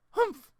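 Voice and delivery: a high, loud indignant humph. A narrator's reading. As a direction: huff like an offended old lady. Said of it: "said Grandmother".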